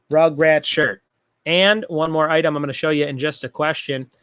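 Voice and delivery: ordinary delivery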